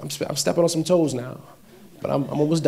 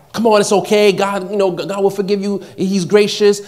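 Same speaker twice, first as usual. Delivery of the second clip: louder, higher coaxing voice; lightly voiced tempter, urging; 6 semitones higher, 6 dB louder than usual. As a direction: insistently talk someone into it. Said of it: "the same tempter who tempted you".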